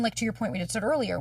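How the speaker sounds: ordinary talk, nothing marked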